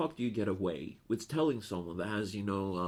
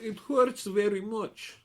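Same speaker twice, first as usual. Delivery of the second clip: high, slow, louder mock patient voice; Russian lady in pain; 12 semitones higher, 7 dB louder than usual. Telling how the Russian patient complained after surgery, mock high pained voice. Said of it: "And she was like".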